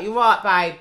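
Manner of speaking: a hint of a smooth Caribbean uncle; lightly voiced flirty uncle. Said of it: "And they're like".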